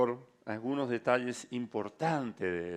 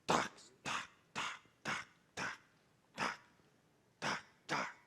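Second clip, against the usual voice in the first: soft unvoiced tac tac, each quieter, fading away; stones dropping one by one; 10 semitones lower, 8 dB quieter, slower than usual. Preacher mimics stones dropping to the ground, fading tac tac. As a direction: click out falling stones, dying off. Empieza a escucharse el ruido de las piedras.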